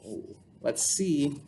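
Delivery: ordinary delivery, nothing marked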